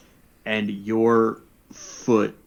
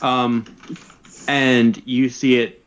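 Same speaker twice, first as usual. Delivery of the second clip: ordinary talk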